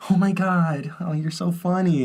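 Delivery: lightly hammed-up amusement; imitating amused listeners. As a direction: put on a tickled admirer's voice. Imitates amused old people.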